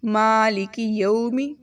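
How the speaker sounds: slow Malik یومی; slow recitation. Shown as a demonstration. Teacher reading slowly for practice. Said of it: "اس طرح نہیں بنے گا".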